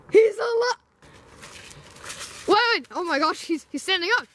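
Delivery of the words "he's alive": startled gasp. Acted. Gasp, zombie rises.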